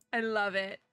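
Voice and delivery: normal talking voice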